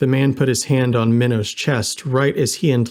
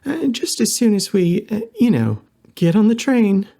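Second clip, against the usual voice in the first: high-pitched, halting character voice; high, urgent hedging; hesitant Lena; 10 semitones higher than usual. A narrator's reading. Lena hesitantly agrees to request.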